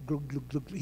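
low, soft glug noises; comic underwater glugging, dropped right down; imitated sinking gurgle. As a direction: mimic someone going under, quietly. Imitates Peter sinking in water.

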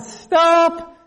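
imitating dad cutting in; retold firm halt. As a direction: call a halt to an argument. Dad halts a long argument.

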